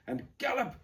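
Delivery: high, breathless cry; gasped cry. Acted. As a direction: gasp it out, urging on. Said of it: "gasped Joris".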